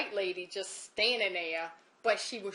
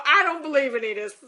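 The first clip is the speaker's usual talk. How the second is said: retold loud, fast, higher-pitched protest; disbelieving outburst; 4 semitones higher, 12 dB louder, much faster than usual. Crying caller refusing to believe it, loud disbelief. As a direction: rush it out loudly in mock upset.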